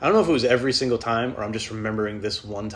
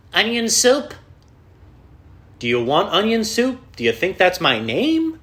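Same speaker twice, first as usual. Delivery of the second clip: much higher, louder baffled repeat, slowing down; incredulous echo; 10 semitones higher, 8 dB louder, slower than usual. Retold or imitated. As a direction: throw their words back in disbelief. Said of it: "And I'm like".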